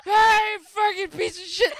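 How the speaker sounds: high, loud mock screaming at grandma from the car; hammed-up yelling out the car window; mock furious yell. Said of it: "and you went".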